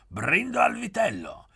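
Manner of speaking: high, loud toasting cry; shouted toast. A narrator's reading. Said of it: "gridò Pieretto".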